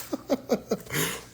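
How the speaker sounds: breathless high laughter; voiceless giggling, falling away; real laugh. Real, not acted. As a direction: crack up at your own tease. Laughs after teasing viewer.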